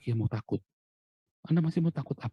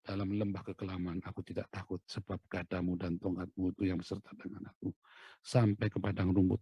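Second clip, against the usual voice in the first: psalm recitation; 2 semitones lower, 6 dB quieter than usual. Shown as a demonstration. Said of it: "quoting a psalm".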